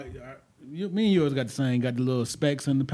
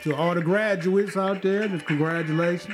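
announcing; 4 semitones higher, 6 dB louder than usual. Real, not acted. Calling out to graduates.

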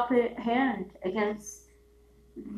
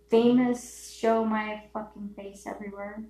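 normal talking voice

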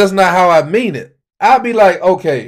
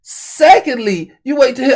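high, drawn-out "secondly"; emphatic telling-off; 7 semitones higher, slower than usual. Acted. Man scolding an imagined friend, emphatic. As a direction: announce the next point slowly, pitched up.

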